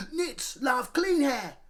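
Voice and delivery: Henry outsmarts Peter; Henry's slow smug comeback. Acted. Henry retorts.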